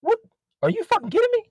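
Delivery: squeaky high outraged disbelief. Real, not acted. Seller reading customer messages at dawn, outraged disbelief. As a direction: shriek it in disbelief.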